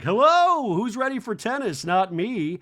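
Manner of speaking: high, loud singsong entrance; hammed-up high greeting, easing down; Garcelle. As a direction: breeze in with a big mock hello. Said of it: "Garcelle arrives and walks in like".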